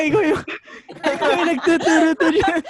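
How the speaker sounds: high-pitched words through real laughter; laughing. Real, not acted. Teasing the one who started it, laughing. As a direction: blame your friend while cracking up. Both crack up, teasing each other.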